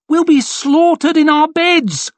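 very high, wailing panic; panicked cry. A narrator's reading. Onlooker fears slaughter by the enemy, panicked cry. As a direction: cry out in terror.

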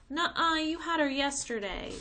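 put-on high, loud childish protest; a small girl arguing over a doll; whiny little girl. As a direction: whine it like a bratty little sister. Squabbling sisters.

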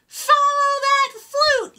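high yelling chase call; yelled. A narrator's reading. Fifi and Frog chasing the flute, high yell. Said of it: "yelled Fifi, Frog, and Fawn".

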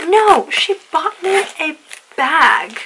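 jaw-dropped amazement; astonished. Real, not acted. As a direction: drag it out in disbelief. Astonished reveal of gift.

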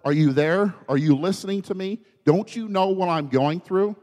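high, urgent cry to God; freaking out. Acted. Psalmist acted out, calling on the Lord, freaking out. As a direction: plead loudly, voice climbing with each question. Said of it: "it says he's freaking out".

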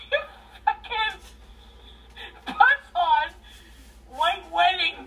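high, loud laugh-crying; laughing so hard it nearly turns to tears. Real, not acted. Drunk laughing-crying story.

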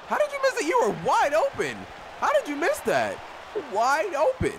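can't believe he missed that; disbelieving yell. Real, not acted. Teammate missed an open shot.

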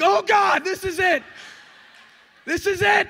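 loud, very high hammed-up scream; comic terrified yelling; mock panic. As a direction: shriek it as if the plane is going down. Acting out panic during flight turbulence.

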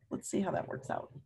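quiet suspense whisper. Real, not acted. Teacher whispering to the class about the pump. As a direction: say it under your breath, teasing. Storyteller building suspense for kids.

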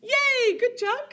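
very high, loud cheer. Real, not acted. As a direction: cheer them on with joy. Cheering kids on.

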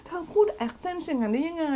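high, breathy-free coy gasp of admiration; coy swooning girl. Acted. Fan playfully swooning to her crush about his accent, coy and high. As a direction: swoon over his accent, a touch girly. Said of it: "ถ้าแปลดูดีๆ ไม่ติดเรท".

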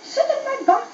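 high sing-song dragon coaxing; luring dragon. A narrator's reading. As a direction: tempt him onto your back. Dragon luring Crookie closer.

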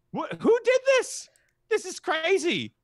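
outraged surprise at what happened to his cousins; shocked outburst. Acted. Ogre finds cousins aged.

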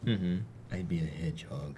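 tiny whispered hedgehog voice; shy snuffly hedgehog. Acted. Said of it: "Is that your hedgehog voice?".

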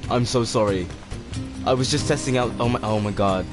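ordinary talk, nothing marked

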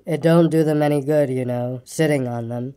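low, gloomy donkey voice; gloomy, long-suffering and low; a low, woeful grumble; melancholy grumbler. A narrator's reading. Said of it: "in his melancholy way".

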